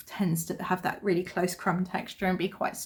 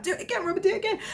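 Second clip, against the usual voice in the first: urging; 11 semitones higher, much faster than usual. Retold or imitated. Urging child to blow nose.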